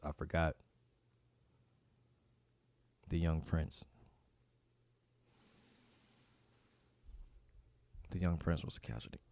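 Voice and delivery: soft, low stunned voice; stunned quiet. Real, not acted. Fan realises the young prince is injured, soft and low. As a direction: let the bad news sink in softly.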